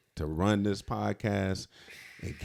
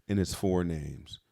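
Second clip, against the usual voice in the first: normal talking voice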